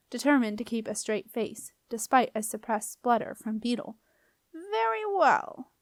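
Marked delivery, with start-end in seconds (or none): 4.5-5.7 high, drawn-out grudging whine; squeaky, put-upon doorkeeper; grumpy. A narrator's reading. Doorkeeper giving in to the girl's demand, grumpy. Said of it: "the doorkeeper replied grumpily".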